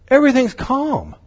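normal talking voice

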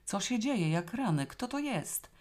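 high-pitched astonished questioning; utter astonishment. A narrator's reading. Paweł stares at an unknown corpse, astonished. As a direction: ask in sheer disbelief, pitch leaping. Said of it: "ze śmiertelnym zdumieniem".

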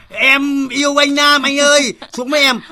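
high, loud, slow mock love call; imitating her yell. Host mimics the girl yelling for Nam, loud and high. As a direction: ham up the girl's shout. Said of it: "gào thét lên".

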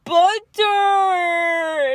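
put-on high loud little kid shout; little nephew calling. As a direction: call the cat like a four-year-old. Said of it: "And he goes".

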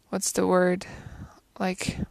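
a real whisper while searching for the word; whispering to herself. Real, not acted. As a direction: breathe it under your breath, as if thinking aloud.

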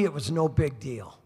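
normal talking voice

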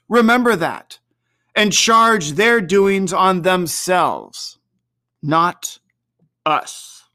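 grand, unearthly spirit rebuke. Acted.

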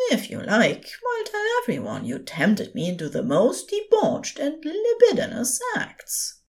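high, wry teasing angel voice; fond angel. A narrator's reading. Angel ruefully teasing the demon beside him. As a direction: tease him as the gentle angel.

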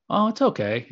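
a high, sighing "Oh, it's okay" that hides a bad day; child playing it down, a touch of hurt in "Oh, it's okay"; telling inflection. Shown as a demonstration. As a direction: let "okay" carry a hint that something went wrong. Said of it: "these small inflections of".

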